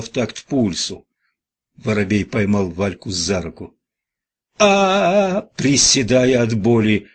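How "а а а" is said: high, loud scream of pain; long, high-pitched howl; yell of pain. A narrator's reading. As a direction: cry out sharply in pain. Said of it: "заорала Валька".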